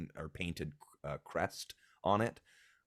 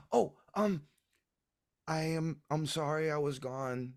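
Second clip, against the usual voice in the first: put-on high, halting little-kid apology; sheepish child; 9 semitones higher, 4 dB louder than usual. Child offers apology gift to mother.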